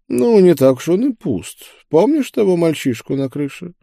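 high-pitched, lively preacher; coaxing preacher. Acted. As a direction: pitch it up and nudge the companion to remember. Voices the preacher in dialogue. (проповедник, высоким голосом)

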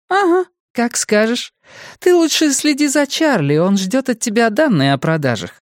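very high-pitched coworker voice, dismissive; high character voice of a chiding colleague; teasing colleague. A narrator's reading. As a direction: brush off the excuse, then remind her of work. Colleague character in narrated dialogue.

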